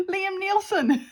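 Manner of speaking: high, squeaky through laughter; name him while cracking up; laughing. Real, not acted. Naming the star of her quote, laughing.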